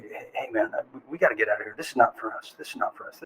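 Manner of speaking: low urgent murmur fading into whisper; hushed warning. Retold or imitated. Recruit to the kid next to him, hushed warning. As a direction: speak under your breath, dropping to a whisper.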